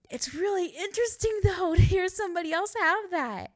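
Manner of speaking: high, loud delighted gushing; real thrill of recognition; excited. Real, not acted. Thrilled someone else has it.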